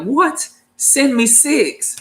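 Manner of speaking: high squeaky What, then slow and drawn out; stunned disbelief. Retold or imitated. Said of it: "I was like".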